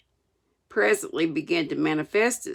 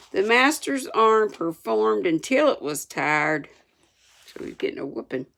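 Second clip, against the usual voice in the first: ordinary delivery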